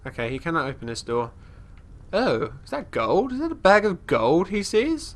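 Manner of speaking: ordinary talk, nothing marked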